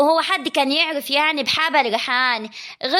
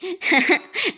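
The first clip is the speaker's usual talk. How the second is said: scornful laugh; 3 semitones higher than usual. Acted. Sara alone, mocking.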